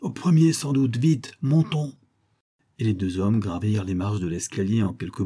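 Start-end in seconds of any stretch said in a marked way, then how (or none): 0.0-2.0 loud, higher rallying order; acted urgent command; urgent cry. A narrator's reading. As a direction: rush them upstairs with a raised voice. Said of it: "cria le commissaire".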